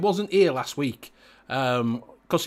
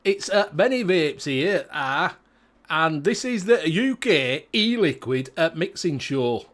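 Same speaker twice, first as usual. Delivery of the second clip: broad, slowed Yorkshire accent played up for the show; slower than usual. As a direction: talk like a Barnsley lad greeting the viewers. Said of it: "Go full accent".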